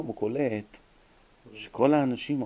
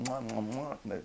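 kissing sounds; 2 semitones lower, 9 dB quieter than usual. Retold or imitated. Kissing the rabbi's hand.

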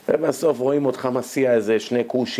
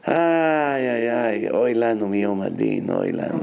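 long groaned lament sinking much lower; woeful lament; 4 semitones lower than usual. Pretend. Preacher dreading the Day of Judgment, woeful lament. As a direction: moan it out, falling to a very low end.

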